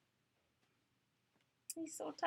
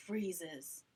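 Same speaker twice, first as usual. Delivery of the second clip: plain reading voice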